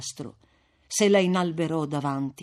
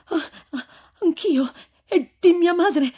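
high, tearful female voice; falter, then ask anxiously and loudly; agitated high voice near tears; overwhelmed emotion; 10 semitones higher, 7 dB louder than usual. A narrator's reading. Lucia overwhelmed, asks after mother.

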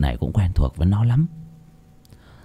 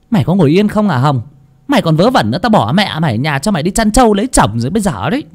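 annoyed mother; 7 semitones higher, faster than usual. Acted. Mother Huyền irritated at Hồng pacing, scolding. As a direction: snap at the fidgeting daughter. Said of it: "lại bực mình".

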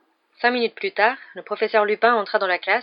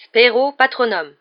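loud, slow, higher spell casting; solemnly declaimed magic formula, at full voice; incantation; 5 semitones higher, 9 dB louder, slower than usual. A narrator's reading. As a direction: proclaim the spell, drawing it out. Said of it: "Lupin s'éclaircit la gorge et dit".

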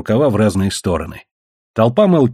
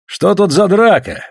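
lively, raised, unhurried question; cheerful; 7 semitones higher, 4 dB louder than usual. A narrator's reading. Russian arriving at a brawl, cheerful. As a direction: ask brightly, amused by the scuffle. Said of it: "весело спросил русский".

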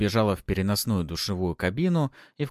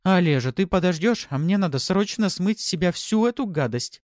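urgent woman; 11 semitones higher, 5 dB louder than usual. A narrator's reading. Hurried woman in a rush.

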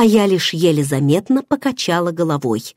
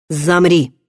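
command. A narrator's reading. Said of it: "приказал Варан".

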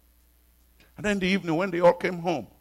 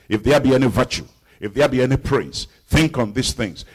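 louder, rhythmic recitation of the verse; preaching scripture; 9 dB louder than usual. Acted. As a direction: proclaim each line to the congregation.

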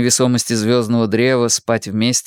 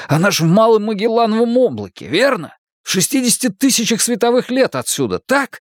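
fast, higher, bitter sarcasm; angry sarcasm; 12 semitones higher than usual. Acted. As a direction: spit out the mocking questions.

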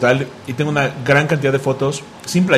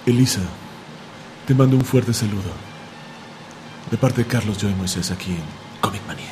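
sexy voice; 6 semitones lower, 5 dB quieter than usual. Pretend. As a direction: purr it like a seductive radio host. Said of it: "que si puedes usar voz sensual".

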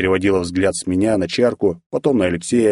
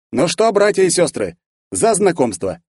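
jovial toast; 9 semitones higher than usual. A narrator's reading. Alexey raising a toast.

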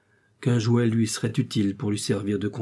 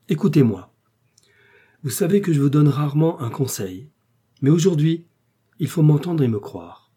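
earnest insistence; 5 semitones higher, 6 dB louder than usual. Acted. Wife insists he listen.

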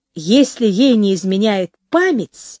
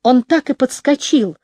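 usual speaking voice

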